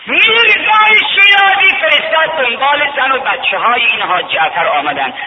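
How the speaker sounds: high, loud chanted preaching; soaring rowzeh-style sermon chant; preaching at full force. Acted. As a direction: lift the journey into a ringing chant.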